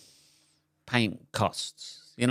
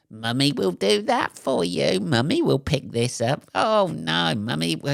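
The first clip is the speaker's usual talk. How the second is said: higher, sing-song parent voice; mock baby-talk mummy; 6 semitones higher than usual. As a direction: coo like a doting mum. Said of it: "parents who start talking like babies".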